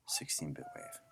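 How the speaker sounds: unvoiced whisper while clicking through menus; soft self-talk whisper; whispered to himself. Real, not acted. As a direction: breathe the words under your breath. Muttering menu choices to himself.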